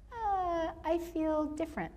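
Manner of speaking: high, hesitant, small voice; imitated uneasy confession. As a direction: act out a man sheepishly admitting discomfort. Uneasy colleague admits discomfort.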